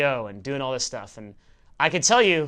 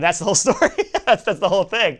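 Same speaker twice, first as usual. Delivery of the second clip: loud, high, amused through laughter; laughing; 6 semitones higher, 7 dB louder than usual. Real, not acted. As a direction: laugh out the punchline. Laughs at the end of own story.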